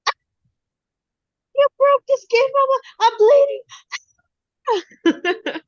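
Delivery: high, loud mock whine of a hurt little girl; imitating crying daughter. Mom imitates her daughter crying over a scratch, high mock wail. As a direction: wail it like a child milking a tiny scratch. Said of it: "she's like".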